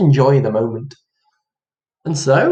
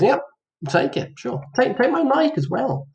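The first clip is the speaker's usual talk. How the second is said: mock-casual giveaway, lifting high at the end; 10 semitones higher than usual. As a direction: shrug it off, then offer more in a higher pitch.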